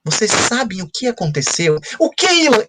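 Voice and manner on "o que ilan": put-on much higher and louder kids' question; imitating eager students. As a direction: mimic the eager pupils begging to know. Students eagerly ask storyteller.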